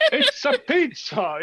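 hammed-up Italian "it's a pizza"; joking Italian accent, slow; a silly pizza-man Italian accent; mock Italian accent.

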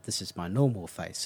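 low, understated deadpan quote. Retold or imitated. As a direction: imitate a dry, expressionless reply. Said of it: "very s-, deadpan".

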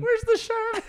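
very high, loud bear voice; comic bear demand. Acted. Bear in jail wanting Charmin, high loud demand. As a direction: call out in a squeaky pitch.

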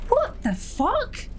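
drawn-out, high and slow; slow disbelieving. Acted. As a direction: stretch it out in disbelief. Exasperated outburst.

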